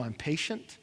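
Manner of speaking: low, soft, grave divine voice; voice of God. Retold or imitated. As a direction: speak as God, quietly final. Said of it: "And God tells them".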